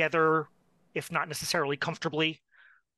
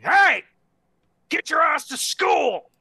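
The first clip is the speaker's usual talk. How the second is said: yelling father, loud and high; gruff shout; 12 semitones higher, 9 dB louder, slower than usual. Acted. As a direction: shout him out of bed. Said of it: "we hear a gruff voice".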